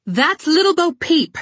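high, slow, loud yell; angry yell. Acted. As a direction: shout it, furious and stamping. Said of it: "Red yelled and stomped her foot".

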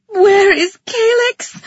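high, loud, drawn-out wail; anguished high cry, stretched slow; desperate wail. A narrator's reading. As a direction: wail it out in panic. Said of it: "I wailed".

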